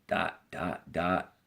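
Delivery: trailing off into a pause; pause marked dot dot dot. Shown as a demonstration. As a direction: let it hang on the dots. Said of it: "That means there's a pause".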